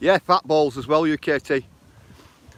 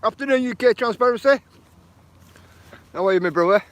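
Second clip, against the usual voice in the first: cheery greeting; 9 semitones higher, 4 dB louder than usual. Real, not acted. Greeting chat viewer on livestream.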